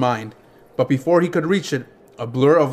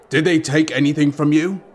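plain reading voice

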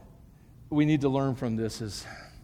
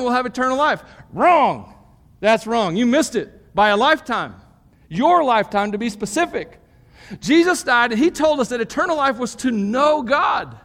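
high, loud preaching voice driving each point home; full-force preaching; 10 semitones higher, 7 dB louder than usual. Acted. Pastor preaching the fruit of the Spirit, at full force. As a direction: preach it out big to the whole room.

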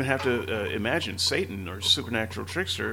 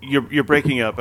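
loud; 4 semitones higher, 9 dB louder than usual. Real, not acted. Calling over a bad line.

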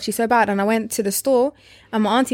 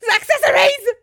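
high cracking wail of heartbreak; anguished wail; 4 dB louder than usual. Retold or imitated. Cracking wail telling friends the DS box was accessories. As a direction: wail it like a crushed child.

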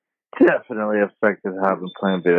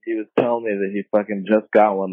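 ordinary delivery

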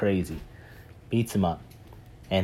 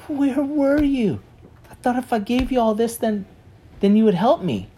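whiny reproach; 13 semitones higher, 6 dB louder than usual. Retold or imitated. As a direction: whine at him, hurt and accusing. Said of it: "It's like".

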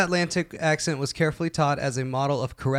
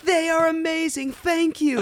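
mock [name] accent; slower than usual. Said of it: "She'd be like".

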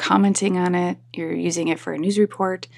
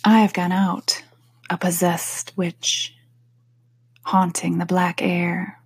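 poem reading; slower than usual. Acted. Reads the poem aloud.

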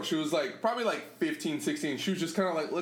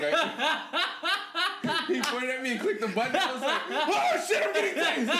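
high voice, cracking up; laughing, high-pitched comic panic; laughing retold panic; 12 semitones higher, slower than usual. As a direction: act out mock alarm through laughter. Relives fake tasing while laughing.

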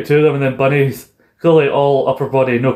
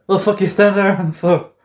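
mock bark; 8 semitones higher than usual. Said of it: "goes like".

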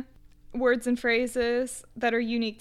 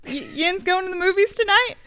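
imitating coworkers; 7 semitones higher, 4 dB louder than usual.

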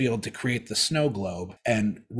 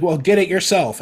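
higher, louder teasing sitcom punchline; sitcom zinger; 9 semitones higher, 8 dB louder than usual. Retold or imitated. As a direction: toss off the sitcom comeback. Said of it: "she's like".

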